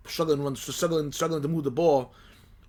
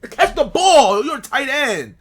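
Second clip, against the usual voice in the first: higher and louder, scolding the tight end; exasperated yell at player; 7 semitones higher, 4 dB louder than usual. Retold or imitated. Fan scolding his tight end through the TV, exasperated yell. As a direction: bark it at the player like he can hear you.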